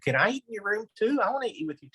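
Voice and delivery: put-on high, fast, eager little girl voice; mock wheedling child begging to join lunch; teacher's pet. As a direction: suck up to the teacher sweetly. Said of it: "little Susie Suckup raises her hand".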